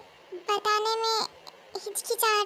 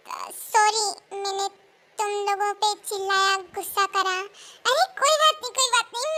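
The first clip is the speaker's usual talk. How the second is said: normal talking voice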